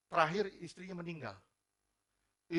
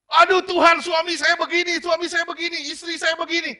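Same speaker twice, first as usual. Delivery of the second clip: high, loud wailing lament; a spouse lamenting loudly to God; anguished complaint; 12 semitones higher, 15 dB louder than usual. Retold or imitated. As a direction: cry out to God about a spouse.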